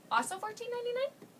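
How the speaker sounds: ordinary talk, nothing marked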